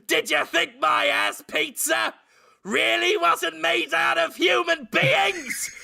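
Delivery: high gloating villain shout. Acted. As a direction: gloat triumphantly. Villain reveal.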